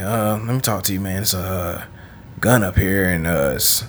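low, quiet, halting officer; hesitant cop, imitated. Cops telling him about a gun upstairs, low and hesitant. Acting the cops breaking news.

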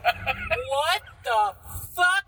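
drawn-out shocked outburst; replaying the moment he slapped her, stunned and slow; shocked what the fuck. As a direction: stretch it out in disbelief. Retelling shocked reaction to slap.

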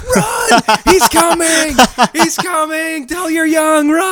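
high-pitched, hammed-up panic cries; mock terrified screaming. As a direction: shriek a warning like fleeing little creatures. Retold kids fleeing in mock terror.

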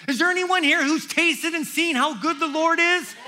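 loud, high call to the congregation; preaching shout. Real, not acted. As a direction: shout it out to the whole room.

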